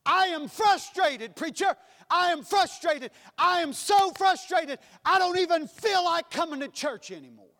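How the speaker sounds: frustrated believer pouring it out to his pastor; exasperated churchgoer. Acted. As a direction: vent at the preacher in exasperation. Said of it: "the next day I wind up doing it".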